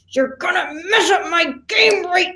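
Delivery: imitated accent, slow and forceful; Gavin. Castaway snapping at the speaker over his game, mock-accented shout. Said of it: "in that accent".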